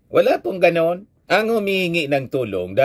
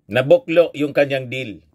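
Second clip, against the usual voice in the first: ordinary delivery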